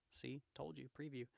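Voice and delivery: plain reading voice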